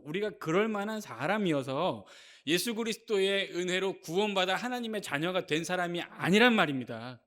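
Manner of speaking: ordinary delivery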